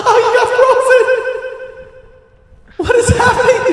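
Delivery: high, loud laughing shriek; laughing squeal. Real, not acted. Laughing at friend freezing.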